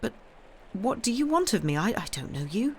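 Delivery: halting, high-pitched nervous question; stammering. A narrator's reading. Girl questioning an unknown man, nervous stammering. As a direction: hesitate and stumble over the words. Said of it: "she stammered".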